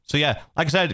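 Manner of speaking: ordinary delivery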